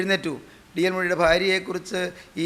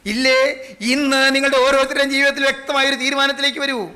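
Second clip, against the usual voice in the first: ringing full-voice preaching; fervent exhortation; 7 semitones higher, 5 dB louder than usual. Real, not acted. Preacher calling congregation to decide today, forceful declaiming. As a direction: proclaim it with unwavering force, stretching the last words.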